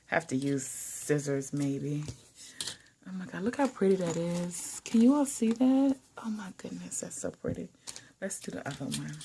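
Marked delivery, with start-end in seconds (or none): 3.1-7.7 hushed breathy wonder; awed whisper. Real, not acted. Vlogger marveling at pretty washi tape, awed whisper. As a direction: whisper as if the tape might break.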